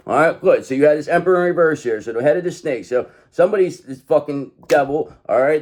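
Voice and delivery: ordinary delivery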